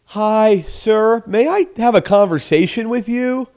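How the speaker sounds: high, loud, sing-song politeness; mock over-polite caller. Shown as a demonstration. Showing how not to approach a stranger, mock over-polite. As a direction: send up a painfully formal sales opener. Mocks wrong way of opening.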